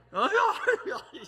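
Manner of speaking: high, loud laughing aiyu. Real, not acted. Preacher laughing at the joke about the handsome pastor: loud, high laughter. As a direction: laugh it off in delight.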